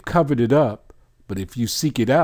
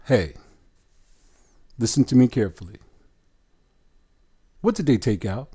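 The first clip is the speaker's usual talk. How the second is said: ordinary delivery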